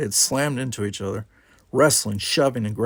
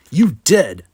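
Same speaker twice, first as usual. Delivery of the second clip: loud, raised accusation; combative retort; 4 semitones higher, 8 dB louder than usual. A narrator's reading. Brickar insists against Worf's denial, combative. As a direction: throw it back louder and higher. Brickar argues back at Worf.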